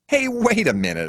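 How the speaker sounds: high, indignant protest. A narrator's reading. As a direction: push back firmly. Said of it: "I protest".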